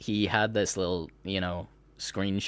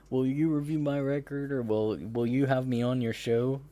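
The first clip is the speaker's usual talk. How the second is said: mock pleading; 4 semitones higher than usual. Mimics artist begging publications for coverage.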